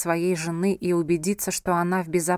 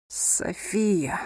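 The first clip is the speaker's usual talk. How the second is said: bitter; 4 dB quieter than usual. A narrator's reading. Wounded husband holds his wife close, bitter. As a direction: say the name bitterly, strength nearly gone. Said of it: "с горечью произнёс Анхель, из последних сил".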